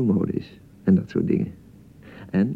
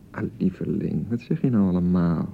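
tender; 7 semitones lower, 5 dB quieter than usual. Acted. Husband soothes bride in quarrel.

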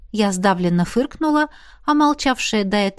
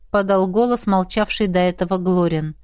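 ordinary delivery, nothing marked

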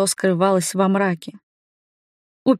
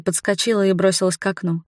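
usual speaking voice

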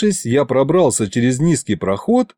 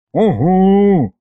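drawn-out, higher-pitched grudging grunt; grumbling assent; 7 semitones higher, 4 dB louder than usual. A narrator's reading. Likhovid reluctantly agrees to help, grumbling. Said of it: "пробурчал Лиховид".

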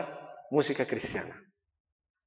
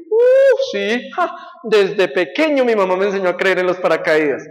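silly passenger; 3 semitones higher, 7 dB louder than usual. Acted.

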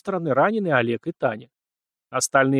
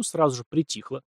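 usual speaking voice

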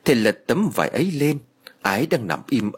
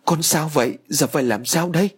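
1 panicked; 5 semitones higher than usual. A narrator's reading. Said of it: "Bà Mỹ nhìn thấy mà hốt hoảng".

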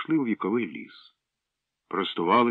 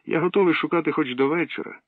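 playful voice; 9 semitones higher, 4 dB louder than usual. Acted. Pri playful voice.

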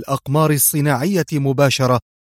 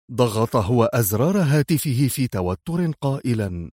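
ordinary delivery

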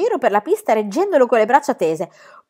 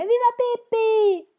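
much higher, slow cheering crowd; slower than usual. A narrator's reading. The spectators cheer Pippi together, shouting. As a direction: chant like a whole audience. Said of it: "gli spettatori gridarono tutti insieme".

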